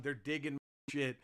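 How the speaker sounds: normal talking voice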